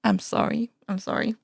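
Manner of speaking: ordinary delivery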